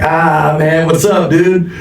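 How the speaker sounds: high-pitched retold buddy greeting; mock-familiar greeting.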